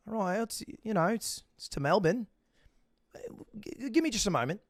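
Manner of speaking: soft Australian mumbling to self; talking to herself. Acted. Airline lady checks the gate, quietly talking to herself. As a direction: imitate a clerk muttering as she works. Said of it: "she starts talking to herself".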